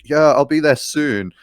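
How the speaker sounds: high, loud, slow dictation; retold yelling. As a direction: act out yelling a message at the phone. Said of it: "I'm, like, yelling at my phone".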